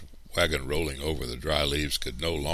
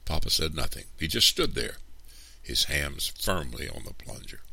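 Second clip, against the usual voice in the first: usual speaking voice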